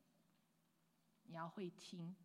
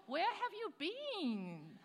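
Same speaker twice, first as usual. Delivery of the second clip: mock question; 8 semitones higher, much slower than usual. Pastor mock-asking the noisy youth.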